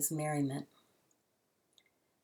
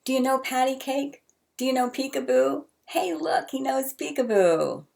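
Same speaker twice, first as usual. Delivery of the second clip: much higher, calling out loud; shouting across the room; 9 semitones higher, 3 dB louder than usual. A narrator's reading. Drunk old man shouting to the baby across the restaurant. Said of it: "the man began shouting from across the room".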